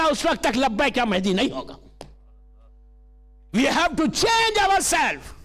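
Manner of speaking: forceful sermon delivery; preaching. Acted. Preacher warning the congregation that ego is a disease, preaching.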